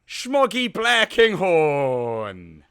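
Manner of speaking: slow, drawn-out grand announcement; announcer introduction. Acted. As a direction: roll out the name like a ring announcer. Introducing the guest on podcast.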